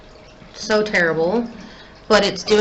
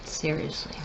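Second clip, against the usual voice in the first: muttered; 5 semitones lower, 14 dB quieter than usual. Real, not acted. Failed eyeliner attempt.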